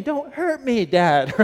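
imitating a screaming son. A dad imitating his son crying to him by the open window, high pleading. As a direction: beg like a frightened little boy. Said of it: "And he's screaming".

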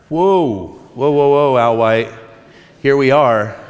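mock-amazed cheer at the news; playful loud whoa, here we are; excited whoa. Son reacting to dad's admission.

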